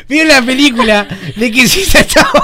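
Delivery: giggling through every word; fighting back laughter, barely speaking; laughing fit. Acted. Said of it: "pero cagándote de risa".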